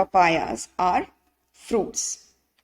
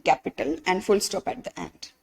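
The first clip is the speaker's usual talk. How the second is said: ordinary talk, nothing marked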